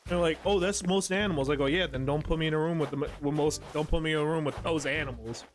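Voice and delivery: ordinary talk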